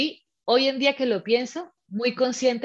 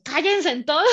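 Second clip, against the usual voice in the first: higher, mock-annoyed order; retold exasperated outburst; 6 semitones higher, 4 dB louder than usual. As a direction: lightly act out snapping at everyone. Wants to silence cheering midwife.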